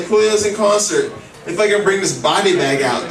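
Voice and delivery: ordinary talk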